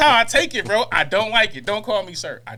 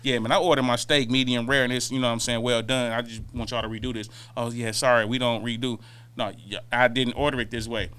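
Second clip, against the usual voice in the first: lightly voiced customer; 10 semitones lower, 5 dB quieter than usual. Acting out a restaurant steak complaint.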